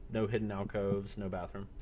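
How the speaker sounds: usual speaking voice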